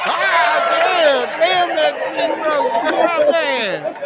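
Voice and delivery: high, loud words through laughter; laughing. Real, not acted. Host still laughing after co-host's joke. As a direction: talk while still cracking up.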